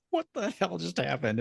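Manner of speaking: high, stunned disbelief; baffled. Retold or imitated. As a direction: gape at something bizarre. Shared baffled look while playing.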